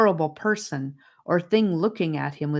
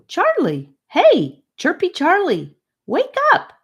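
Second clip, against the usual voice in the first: high, loud call to wake him; 13 semitones higher, 7 dB louder than usual. Acted. Gabe calling to wake sleeping Charlie, loud high call. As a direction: shout his name from across the room. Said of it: "Gabe's voice from a distance calling him".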